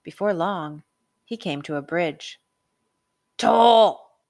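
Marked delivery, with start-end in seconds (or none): 3.4-4.2 very loud barked demand; demanding troll. A narrator's reading. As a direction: bark the toll like a bridge troll. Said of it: "a voice demanded".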